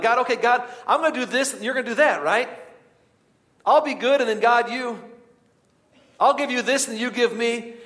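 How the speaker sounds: high, loud wheedling deal-making; mock bargaining. A believer bargaining with God, high and pleading. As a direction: haggle with God like a trader.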